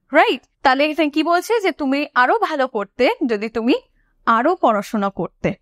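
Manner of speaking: ordinary talk, nothing marked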